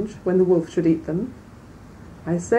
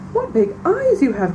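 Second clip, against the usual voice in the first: higher, lightly voiced child in wonder; girl questioning the disguised wolf in wonder; wondering little girl; 6 semitones higher than usual. A narrator's reading. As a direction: marvel at it like a small girl.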